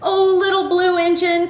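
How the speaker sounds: high-pitched toys calling to the engine; pleading cry. A narrator's reading. Dolls calling to the blue engine, pleadingly cried. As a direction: cry out in appeal, drawing out the Oh. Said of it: "cried the dolls and toys".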